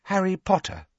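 put-on high, careful girl's voice; tentative. Hermione giving Harry's name to the elf, tentatively. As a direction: offer the name gingerly. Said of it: "said Hermione tentatively".

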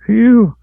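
high, loud relieved blow; relieved phew. A narrator's reading. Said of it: "said Reese".

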